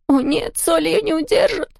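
high, frightened voice; panicked wail. A narrator's reading. Character despairs as the ghost breaks in, high and scared. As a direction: despair as the salt fails.

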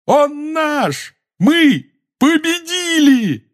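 jubilant high, drawn-out shout; beside himself with joy. A narrator's reading. Ron to his friends after winning, shouting with joy. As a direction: scream the victory out, elated. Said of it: "Он был вне себя от счастья".

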